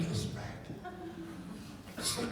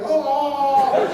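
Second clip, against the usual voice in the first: loud, high dying yell; hammed-up dying wail; mock death cry; 7 semitones higher, 8 dB louder than usual. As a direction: overplay getting shot. Pretending to be shot.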